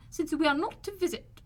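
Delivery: high, resentful complaint; resentful mother. A narrator's reading. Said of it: "said her mother resentfully".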